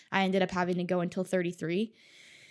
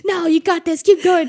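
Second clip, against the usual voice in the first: much higher, louder cheer; 10 semitones higher, 13 dB louder than usual. Retold or imitated. Runner yelling encouragement to a struggling racer, high and loud. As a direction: yell encouragement as you pass. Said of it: "And I'm like".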